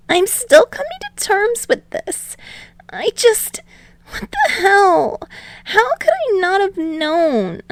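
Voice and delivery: put-on high, bewildered young voice; a boy reeling from the news; high-voiced Izuku struggling to take it in; stunned teen boy.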